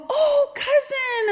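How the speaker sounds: high, drawn-out happy cry of recognition; delighted greeting. Retold or imitated. Masked warriors recognizing a young relative, delighted greeting.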